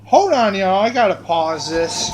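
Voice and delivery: sudden loud interruption; calling out. Real, not acted. Storyteller halting the tale for listeners, calling out. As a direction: break in loudly over your own story. Breaks off story to address audience.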